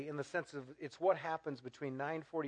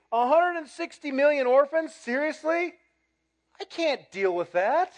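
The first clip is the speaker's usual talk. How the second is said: louder and higher, mock shocked; mock overwhelmed disbelief; 11 semitones higher, 13 dB louder than usual. Churchgoer staring at the orphan card, mock disbelief. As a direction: throw up your hands at the number. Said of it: "You'll look at this card and you say".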